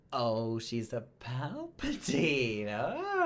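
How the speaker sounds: slow, drawn-out dawning horror, soaring at the end; retold shocked realization. Podcast host replays their reaction to the reveal, mock-shocked. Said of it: "I remember being like".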